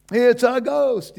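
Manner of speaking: high, loud, slow scared cry; mock terrified cry. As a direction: cry out like frightened sailors. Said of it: "what do they do?".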